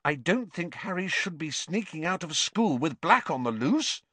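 high-pitched disapproval; scolding Hermione; sharp. A narrator's reading. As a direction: object primly and firmly. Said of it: "said Hermione sharply".

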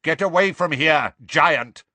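loud commanding centaur; centaur calling out. Acted. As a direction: call out a stern warning. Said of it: "called Megorian".

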